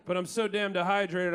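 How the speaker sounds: usual speaking voice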